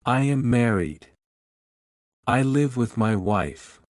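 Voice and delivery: normal talking voice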